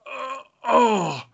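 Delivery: high, drawn-out fake moans; mock moaning. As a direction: moan it like she's overcome. Mocking her moaning every time.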